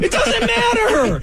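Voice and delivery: high, slow shouted protest; exasperated shout. Real, not acted. Heated denial in argument.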